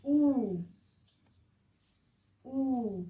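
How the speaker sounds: ऊ drawn out long and loud; ऊ को लंबा और ज़ोर से बोलें. Shown as a demonstration. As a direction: stretch ऊ into a long oo for the class. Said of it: "ये लॉन्ग साउंड है".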